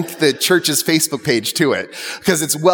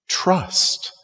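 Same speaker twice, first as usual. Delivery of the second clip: hushed plea; 2 semitones higher, 6 dB quieter than usual. Retold or imitated. Jesus quoted, pleading softly.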